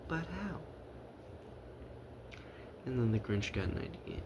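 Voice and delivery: ordinary delivery, nothing marked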